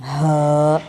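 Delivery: ہا pushed from the chest, a little hard. Shown as a demonstration. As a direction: breathe ہا out firmly from the chest. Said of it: "اس ہا کو آپ نے سینے سے آواز نکال کے".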